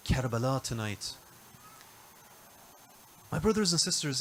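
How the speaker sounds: lamenting recitation. Acted. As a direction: draw the listeners into the mourning, unhurried. Shifts into mourning narration of Karbala.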